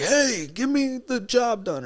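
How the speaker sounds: high, drawn-out "hey" in a put-on voice; mock movie tough guy. Mocking the actor's role in the trailer, high put-on pushy voice. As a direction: ham up an actor's pushy catchphrase, slowly. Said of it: "it suits him to be like".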